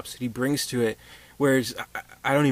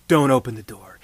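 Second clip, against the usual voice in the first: "Don't" set firm and louder; firm order; 7 dB louder than usual. Retold or imitated. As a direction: give the order firmly, "Don't open the door.". Doctor's firm instruction.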